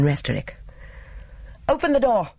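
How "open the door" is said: high-pitched shouted demand; insistent, high cry, rising on the repeat; urgent shout. A narrator's reading. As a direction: call urgently through a locked door. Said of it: "he shouted".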